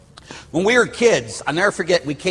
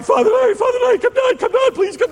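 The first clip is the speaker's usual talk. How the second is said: much higher, pleading, mock-tearful; a retold frantic plea, pitched way up; imitating crying plea; 3 dB louder, faster than usual. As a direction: beg him to come down like a frightened crying kid. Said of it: "And he's crying".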